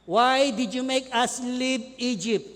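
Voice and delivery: loud accusing complaint, slow at the end; blaming Moses. Acted. Israelites blaming Moses, loud angry questions. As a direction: scold Moses like a fed-up crowd. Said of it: "ang sisi nila kay Moses".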